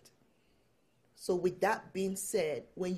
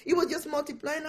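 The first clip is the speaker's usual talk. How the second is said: laughing; 7 semitones higher than usual. Real, not acted.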